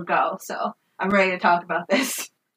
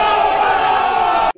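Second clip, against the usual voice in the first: startled shout; 11 semitones higher, 6 dB louder than usual. Retold or imitated. Deer suddenly stands back up.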